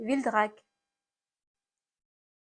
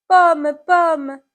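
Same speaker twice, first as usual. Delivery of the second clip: loud, higher call to the apple; 6 semitones higher, 9 dB louder than usual. Acted. Someone calls to the fallen apple, calling out. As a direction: call out with alarm, pitch raised.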